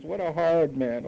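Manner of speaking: slow sulky mock grumble; hammed-up complaint. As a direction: repeat the whine, drawn out. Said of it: "you say".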